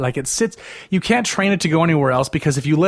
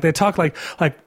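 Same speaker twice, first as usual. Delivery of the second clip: ordinary delivery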